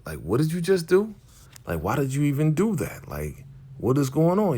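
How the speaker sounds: high-pitched retold disbelief; incredulous. Tommy hearing D-Mac made a hook-up, incredulous. Tommy stunned by D-Mac's news.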